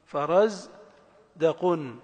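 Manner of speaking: فرز rising, pause, then دقن falling; syllable by syllable. Shown as a demonstration. Teacher repeating the example for the ear, فرز and دقن in separate beats. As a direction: keep فرز apart from دقن. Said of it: "قوية في التنغيم ومعرفة المقاطع الكلمات".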